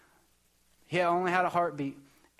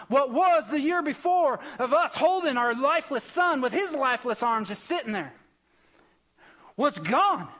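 preaching; 4 semitones higher than usual. Acted. Pastor preaching to the church about prayer, fervent build.

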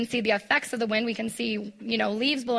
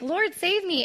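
a higher repeated plea, lightly acted; mild mock cry for help; 8 semitones higher than usual. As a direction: echo the cry for help, understated. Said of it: "he cries out".

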